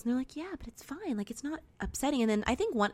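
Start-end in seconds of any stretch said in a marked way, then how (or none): 0.4-2.2 soft, high-pitched retold brush-off; lightly smooth it over, high and soft; airy reassurance. Hosts telling guests it's fine, softly dismissive.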